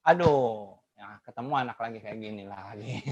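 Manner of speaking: low, soft, drained sigh; weary dread. Retold or imitated. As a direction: sound worn out before even starting. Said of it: "intonasi kita, suara kita berbeda".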